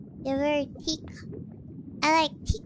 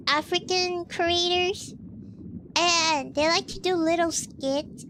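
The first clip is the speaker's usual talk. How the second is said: ordinary delivery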